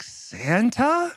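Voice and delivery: imitated little kid's rising, hopeful question; wondering child. [name] answering Santa on the phone, imitated with a rising, wondering lilt. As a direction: draw it out upward like an unsure child.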